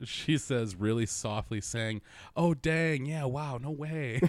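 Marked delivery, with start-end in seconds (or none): 2.4-4.2 higher, louder drawn-out amazement; mock-astonished celebrity imitation; Owen Wilson. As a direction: do a wowed movie-star impression. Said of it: "Doing her own Wilson voice".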